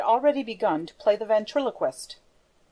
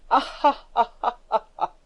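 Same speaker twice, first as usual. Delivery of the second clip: evil laugh; 3 semitones higher than usual. Acted. Erik's triumphant ventriloquist laugh.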